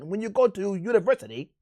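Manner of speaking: lightly imitating a posh British speaker; British accent. Streamer mimicking Andrew Tate in a light British accent. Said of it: "like, British".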